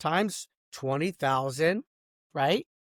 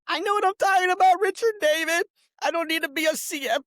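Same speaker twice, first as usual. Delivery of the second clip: sharp, high and loud, jabbing at him; angry outburst; 11 semitones higher, 3 dB louder than usual. Real, not acted. Ranting at ex-partner Richard David, angry raised voice.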